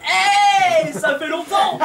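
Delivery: loud high hey, fake-delighted; excited greeting. Acquaintance hailing the comedian on the platform, overjoyed shout. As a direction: greet an acquaintance you'd rather avoid.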